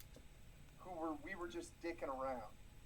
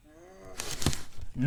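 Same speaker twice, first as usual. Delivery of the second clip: imitating a sleepy hum; drowsy closed-mouth mm; 4 semitones higher, 12 dB louder than usual. Mock sleepwalker answering with a hum. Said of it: "and she said".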